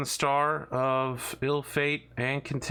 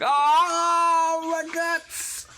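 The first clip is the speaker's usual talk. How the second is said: high, loud mock agony scream; burning scream; 7 dB louder, much slower than usual. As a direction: shriek it like your guts are torn out. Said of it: "I'll scream in agony as it happens".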